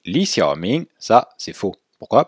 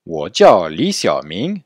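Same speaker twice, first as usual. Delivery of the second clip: wo jiao Xiaoming spoken at half speed, a bit louder; slow model phrase; 5 dB louder, much slower than usual. Shown as a demonstration. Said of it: "ça c'est bon aussi". (wo jiao Xiaoming, lentement et articulé)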